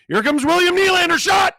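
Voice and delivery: high loud play-by-play yell; excited shout. Real, not acted. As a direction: yell the shot like a goal call. Excited shot call.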